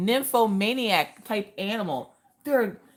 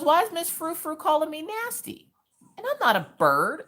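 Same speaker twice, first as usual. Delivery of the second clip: lightly voiced protesting child, pitched up; retold hurt daughter; 9 semitones higher than usual. Daughter complaining to Mama about being called nasty, retold hurt protest. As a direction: imitate a child's mild indignant protest. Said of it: "She goes".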